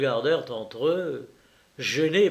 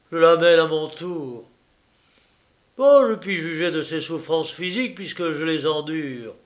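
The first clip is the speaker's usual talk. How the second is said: put-on louder, bitter, defiant young man; Jacques accusing; 3 semitones higher, 6 dB louder than usual. Jacques accusing Clémence before the guests, bitter defiance. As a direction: challenge every guest.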